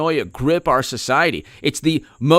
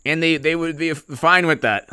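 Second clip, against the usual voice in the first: ordinary talk, nothing marked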